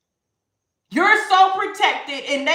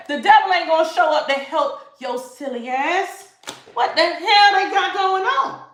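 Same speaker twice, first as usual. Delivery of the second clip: ordinary delivery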